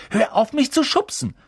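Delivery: very high, indignant protest; heated, high-pitched complaint; loud squabbling. A narrator's reading. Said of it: "wie laut sie sprachen".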